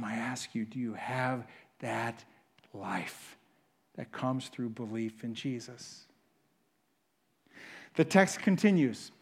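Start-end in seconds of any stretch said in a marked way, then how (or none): 0.0-6.1 hushed appeal. Real, not acted. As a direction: lean in and ask it quietly. Preacher drops to intimate appeal.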